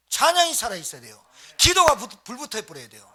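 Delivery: loud, forceful preaching cry; urgent pulpit shout; fervent exhortation. Real, not acted. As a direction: fire up the congregation at full voice. Preacher urging the congregation with fervour.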